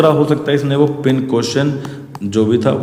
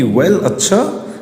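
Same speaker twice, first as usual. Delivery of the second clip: higher, slow and sharp; a touch of sharp anger, pitched up; sharp father; 6 semitones higher, much slower than usual. Retold or imitated. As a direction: bite off the words like a cross father. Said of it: "he said sharply".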